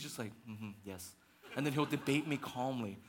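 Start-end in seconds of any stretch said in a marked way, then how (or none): 0.5-1.1 low, soft, unbothered mm-hmm; calm agreement. Retold or imitated. Pastor imitating calm colleague in a debate, low and soft. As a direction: imitate a colleague staying cool. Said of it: "he remains calm".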